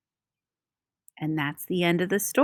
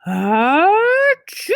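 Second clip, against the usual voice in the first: loud high achoo; a hammed-up sneeze; big bear sneeze; 9 semitones higher, 5 dB louder than usual. A narrator's reading. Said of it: "makes the bear".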